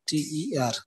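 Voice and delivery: "T E R" letter by letter; name each letter of "T E R" separately; spelled out. Shown as a demonstration. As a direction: spell "T E R" as the last syllable. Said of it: "These are three syllables".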